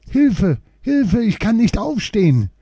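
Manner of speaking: high, loud cry for help with a touch of sobbing. A narrator's reading. Marlin fallen and hurt calls for help, mildly sobbing. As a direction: call for help, hurt and tearful, lightly acted. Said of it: "schluchzte sie".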